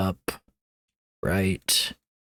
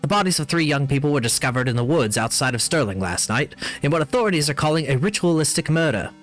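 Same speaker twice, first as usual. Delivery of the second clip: news read; 4 semitones higher than usual. Acted. Reads in-game newspaper article.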